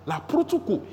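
high-pitched climbing prayer cries; fervent tongues prayer. Shown as a demonstration. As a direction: pray it out, pitch climbing sharply. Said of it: "Mister Femi said".

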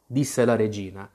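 plain reading voice

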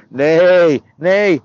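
loud, drawn-out neighs; horse neighing. Acted. Boy showing God his horse sounds, loud neighing. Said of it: "I can also do horses".

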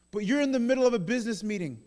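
put-on high, quick child's protest; urgent objection. Child to busy father, urgently objecting. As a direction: blurt out the objection fast.

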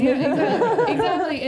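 loud, high, eager agreement; excited. Real, not acted. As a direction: burst out agreeing. Eager agreement with friend's joke.

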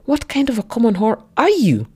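put-on furious insult shouted at his girlfriend; angry shout. A narrator's reading. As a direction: hurl the insult at her. Jealous man keeps shouting insults.

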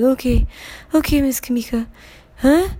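a dazed voice just roused from sleep; sleepy secretary. Retold or imitated. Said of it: "She's like".

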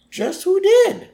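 very high, theatrical demand; hammed-up courtroom challenge; dramatic lawyer. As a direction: pounce on the witness with a high-pitched question.

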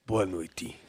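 Lula impression. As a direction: greet them like a low Lula. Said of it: "A imitação tá boa".

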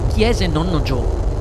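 ordinary delivery

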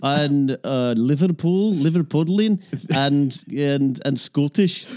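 lightly put-on Scottish with a Liverpool slide; a Scots voice drifting into Scouse; Scottish accent slipping to Liverpool. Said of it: "You're slipping a bit into Liverpool".

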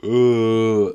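loud burp mid sentence. Real, not acted. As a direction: let a burp slip out. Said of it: "okay, that was disgusting".

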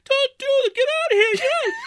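imitating a crazed shouter; frantic yelling. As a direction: shoo everyone out in a panic. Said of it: "just like".

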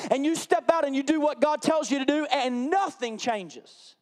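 loud, rising preacher cadence, pressing each line; full-force preaching. Acted. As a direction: preach it hard to the congregation.

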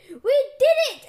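high, loud victory cry; excited exclamation. A narrator's reading. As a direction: burst out in triumph. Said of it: "exclaimed Pork Belly".

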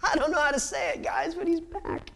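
can't hold it in, blurt it out; breathless excitement. Acted. Mary tells the disciples.

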